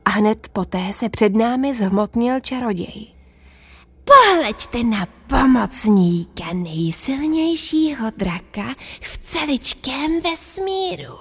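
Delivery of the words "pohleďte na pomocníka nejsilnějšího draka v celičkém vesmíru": slow, pompous sorcerer voice; boasting wizard. Acted. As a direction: crow about your master. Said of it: "křičel mák".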